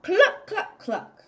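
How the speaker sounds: high, loud clucks fading off; hen clucking. Acted. Reading to a child as the hen, bright clucking. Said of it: "I'm a hen and I say".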